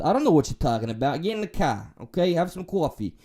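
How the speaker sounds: playful, put-on New York accent; hammed-up New Yorker accent. Shown as a demonstration. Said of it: "the New York accent".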